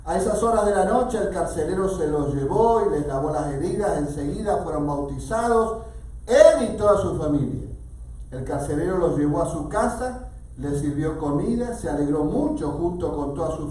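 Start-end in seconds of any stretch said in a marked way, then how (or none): none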